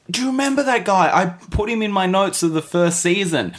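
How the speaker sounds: high, fast, excited blurt; excited recall. Retold or imitated. Podcaster recalling spotting the guy: high excited blurt. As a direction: blurt it out like a sudden realization. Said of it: "I saw that and I said".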